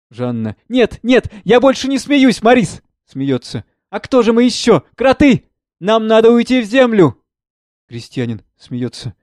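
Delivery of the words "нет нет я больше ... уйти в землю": high, giddy, barely holding laughter; laughter edging on panic; hysterical mirth. Acted. (сквозь смех, истерично)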